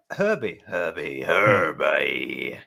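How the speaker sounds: playfully calling a name, sliding down very low. Pretend. Host teasingly calls the guest's nickname.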